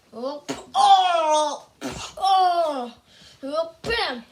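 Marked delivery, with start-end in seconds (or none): none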